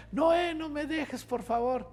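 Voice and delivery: high, slow whimpering plea, half mock; tearful plea. Dragged off, begging cameraman not to leave, high whimpering plea. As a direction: whine it like a scared kid about to cry. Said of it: "Muy, muy machito al principio".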